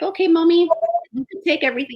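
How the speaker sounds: put-on sweetly agreeing child; young son's voice.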